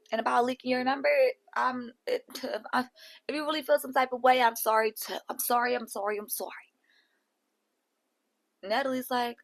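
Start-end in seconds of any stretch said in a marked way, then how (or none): none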